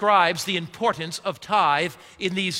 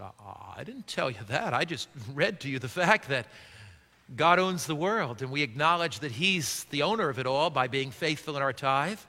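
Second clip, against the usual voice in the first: quiet retold reply; 5 semitones lower, 6 dB quieter than usual. Pastor retells his own reply.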